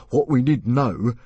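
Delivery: slow, loud, putting on authority; forced confidence. Acted. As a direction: sound in charge though you're not. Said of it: "trying to sound as if he was on top".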